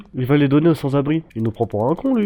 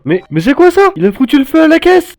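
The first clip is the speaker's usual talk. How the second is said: high panicked shouting; shocked high yell; alarmed shout; 14 semitones higher, 8 dB louder than usual. Real, not acted. Killer set the register afire.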